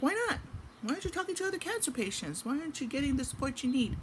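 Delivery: high, insistent retold challenge; incredulous questioning. Neighbor urging a cancer patient to seek support, incredulous. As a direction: press with high-pitched, disbelieving questions.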